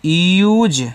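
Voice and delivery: यूज said slowly as "yooz"; model pronunciation. Shown as a demonstration. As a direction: draw out यूज with a long oo and a z ending. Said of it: "ऐसे".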